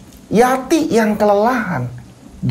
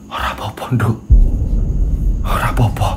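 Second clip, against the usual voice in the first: hushed, lower whisper holding back tears; grief-stricken whisper; 9 semitones lower, faster than usual. Acted. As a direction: whisper it so the child won't worry. Mother crying, hides her grief. (ibu berbisik menahan tangis pada anaknya)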